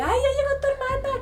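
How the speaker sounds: high mock-teammate voice; brother's teammates greeting. Brother's teammates greeting the crazy sister, high imitating voice. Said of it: "me saludaban así de".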